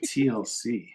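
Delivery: blank, unrecognizing repeat; puzzled echo. Retold or imitated. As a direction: echo it as if it means nothing. Puzzled echo of band name.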